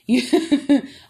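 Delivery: a burst of loud, high laughter. Real, not acted. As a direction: crack up at your own joke. Laughs at playing stepmommy.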